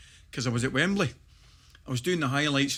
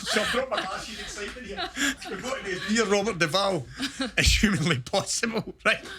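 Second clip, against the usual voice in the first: higher voice breaking into real laughter; laughing while talking; 7 semitones higher than usual. Real, not acted. Telling the Robert Duvall film story, laughing as he talks. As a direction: tell it through giggles.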